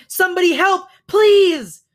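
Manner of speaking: very high, loud plea; frantic, high-pitched begging shout; desperate cry for help. A narrator's reading. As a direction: scream for rescue. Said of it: "cried a mother hare".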